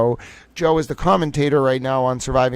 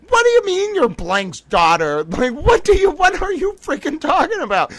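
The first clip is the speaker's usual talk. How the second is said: incredulous; 13 semitones higher than usual. Retold or imitated. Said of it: "And I was like".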